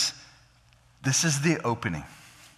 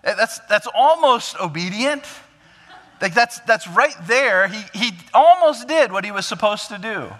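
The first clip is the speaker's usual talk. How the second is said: much higher, louder self-satisfied thought; mock approving reasoning; 9 semitones higher, 6 dB louder than usual. Listener imagining Saul's excuse, raised and approving. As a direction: sound pleased with near obedience. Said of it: "it would be easy to think".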